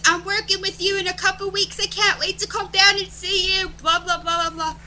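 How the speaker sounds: very high, chirpy imitation of a caller; Davey. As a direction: rattle on in a put-on high voice. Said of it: "He goes".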